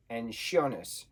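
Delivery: "Jonas" said with a sh sound, like Shonas; "Jonas" starting with sh instead of J. Shown as a demonstration. Comparing the German pronunciations of the name, sh sound. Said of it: "J, sh, and what was the other one?".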